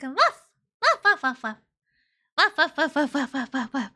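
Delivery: mock dog barks, loud at first then fading into a laugh; playful barking. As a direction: bark like a happy puppy, then giggle it out. Barking for wolf lady.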